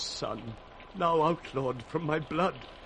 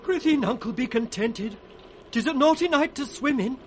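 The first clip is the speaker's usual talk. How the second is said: urgent pleading; 8 semitones higher, 5 dB louder than usual. Acted. Fool pleading with Lear.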